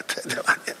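breathy confiding whisper; whispered aside. Acted. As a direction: lean in and whisper it. (לחישה סודית)

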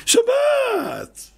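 very high, long drawn-out shout; elated stretched exclamation; festive cry. Shown as a demonstration. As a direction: call out Shabbat in celebration. Joyful exclamation about the Sabbath table.

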